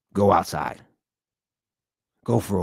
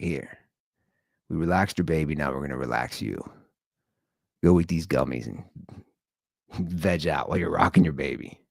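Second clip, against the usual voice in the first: soothing sales pitch; 5 semitones lower, 3 dB quieter than usual. Pretend.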